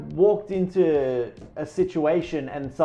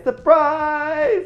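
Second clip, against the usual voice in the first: higher, louder "Surprise!"; party surprise shout; 11 semitones higher, 5 dB louder than usual. Acted. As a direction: jump out and yell it at the guest. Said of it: "did everybody jump out, out and say".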